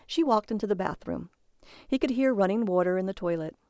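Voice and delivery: usual speaking voice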